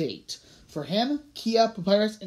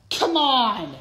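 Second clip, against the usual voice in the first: higher, louder objection; exasperated, pitched-up protest; 5 semitones higher, 6 dB louder than usual. A narrator's reading. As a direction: complain with a raised voice. Everest protests the phone rule.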